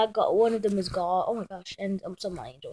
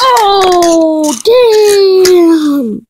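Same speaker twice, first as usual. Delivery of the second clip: loud, high laughing yelp into a shout; shouted cry; 7 semitones higher, 14 dB louder than usual. Pretend. Kid crying out loud at a mishap in the game. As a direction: cry out loud when it goes wrong.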